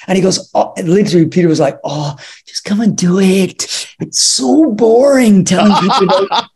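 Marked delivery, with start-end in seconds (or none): 1.8-6.4 exasperated, higher-pitched pleading; hammed-up weary complaint; mock whine. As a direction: moan about the boredom, imitating a fed-up friend. Retold director whining about boring commentary.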